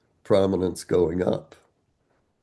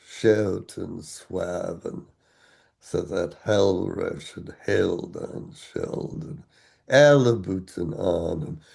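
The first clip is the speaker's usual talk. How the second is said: put-on Old English recitation; 2 semitones lower, slower than usual. Shown as a demonstration. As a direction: chant the lines heavy-light, unhurried. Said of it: "recitation style is based on Pope".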